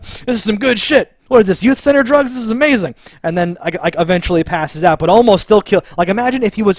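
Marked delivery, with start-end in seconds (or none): 0.0-2.9 high, loud giddy stoned voice; mock drugged villain. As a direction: play a woozy fighter enjoying the drug. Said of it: "staggering and be like".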